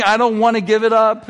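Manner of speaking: hammed-up tantrum protest; retold screaming. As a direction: imitate a child's shouted refusal.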